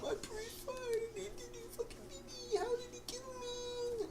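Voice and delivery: high-pitched whining complaint; whiny disbelief. Real, not acted. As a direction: whine about the unfair death. Complaining about the death.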